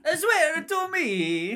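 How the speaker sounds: very high, loud, stretched-out mock roar; Batman impression. Co-host echoing Batman's interrogation, hammed-up slow roar. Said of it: "And he's like".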